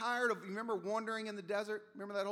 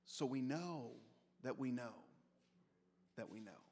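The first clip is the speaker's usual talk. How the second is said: each "we know" softer and lower, fading to almost nothing; fading to a hush; 9 semitones lower, 9 dB quieter than usual. Real, not acted. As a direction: let the repeated words sink into a hush. Preacher repeating, voice sinking away.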